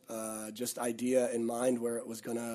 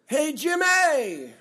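loud, high, drawn-out holler; imitated rowdy shout to a coworker; calling out; 14 semitones higher, 8 dB louder, much slower than usual. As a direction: yell it across a restaurant, stretching the name. Retelling waiter calling out mid-conversation.

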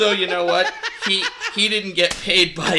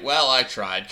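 lower, softly mock-resigned; half-hearted resignation; 6 semitones lower, 4 dB quieter than usual. Co-host voicing Zoe's giving-up energy, half-hearted. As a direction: give up with a mild shrug in the voice. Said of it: "kind of energy".

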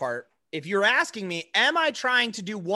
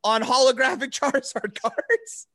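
high, breaking into laughter; laughing; 7 semitones higher, 4 dB louder than usual. Real, not acted. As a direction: talk while laughing hard. Laughing through the plea.

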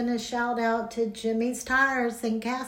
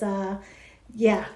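normal talking voice

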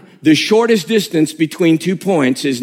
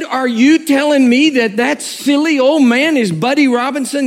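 incredulous; 7 semitones higher than usual. Retold or imitated. Disbelief at the clerk.

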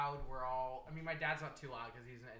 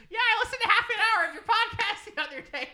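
high, loud mock mom voice; imitating mom; 16 dB louder than usual. Son mimics mom bragging about his podcast, high and loud. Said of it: "Mom's like".

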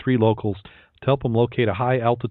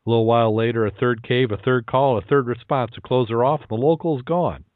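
ordinary talk

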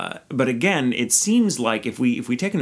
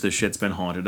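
ordinary talk

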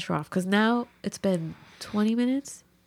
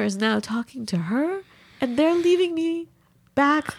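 ordinary talk

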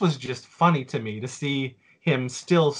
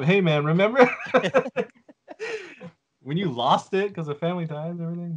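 teasing, laughing; 5 semitones higher, faster than usual. Retold or imitated. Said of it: "I brought it up".

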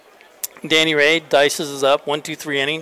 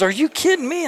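much higher, incredulous retold coach; mock disbelief; 12 semitones higher than usual. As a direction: play the coach's stunned disbelief. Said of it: "He goes".

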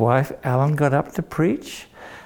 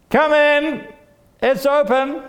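much higher, called through the house; calling out; 12 semitones higher than usual. Acted. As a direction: call out from bed to the door. Treasurer calls out from bed.

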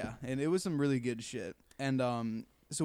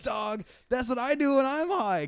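excited; 9 semitones higher, 5 dB louder than usual. Retold or imitated. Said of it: "I'm like".

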